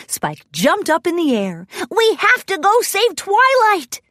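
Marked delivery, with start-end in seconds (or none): 1.9-4.0 high, urgent little dragon voice; eager, pitched-up call to action; excited rallying cry. A narrator's reading. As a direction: cry it out high, ready for a rescue. Dragon jumps up, urging rescue.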